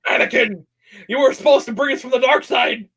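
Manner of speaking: high, hammed-up Star Wars plea; mock-dramatic reproach. Fan playing a Jedi scolding Anakin, high mock-dramatic reproach. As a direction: cry out in mock betrayal, pitched high.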